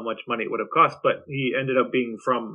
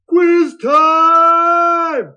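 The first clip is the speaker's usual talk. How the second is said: big high game-show shout; announcer shout; 10 dB louder than usual. Acted. Host hypes the quiz segment with a big shout.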